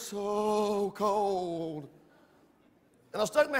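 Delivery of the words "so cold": "so cold" stretched very slowly; drawn-out emphasis. Real, not acted. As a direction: draw out both words in "so cold". Stresses how cold it was.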